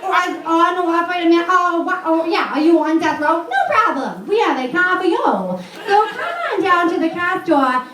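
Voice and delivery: hammed-up local car commercial pitchwoman with New England accent; Lois. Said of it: "she sounds just like, uh, Lois".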